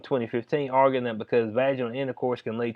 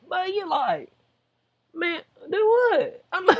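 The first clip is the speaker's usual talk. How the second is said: high, squeaky mock disbelief; incredulous; slower than usual. Vlogger reacting to the age gap, incredulous. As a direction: draw it out high, as if baffled.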